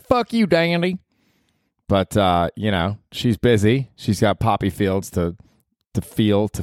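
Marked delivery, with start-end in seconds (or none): 0.0-1.0 higher put-on girlfriend snap; imitating girlfriend. Girlfriend cursing Danny, higher put-on voice. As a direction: fire it back high in her voice. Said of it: "I was dating Ruth Mozart".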